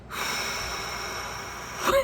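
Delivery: long acted-out exhale; nervous sigh. Student told to do a K turn, nervous exhale. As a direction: blow out a long, bracing breath. Imitates breath before the K turn.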